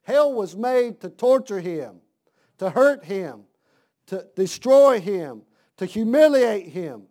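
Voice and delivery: slow, loud preaching punches; full-force pulpit preaching. Acted. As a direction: hammer each phrase home to the congregation. Preacher building emphatic points.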